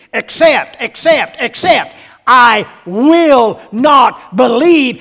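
loud, high insistent refusal, last line slow; stubborn preacher's cry of Thomas; hammered, defiant refusal; Thomas demanding. Retold or imitated. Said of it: "Thomas demanding".

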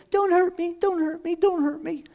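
small, high mock-pleading voice; feigned fear. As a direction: play scared, squeak it high. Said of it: "you'll shrink down, and you'll say".

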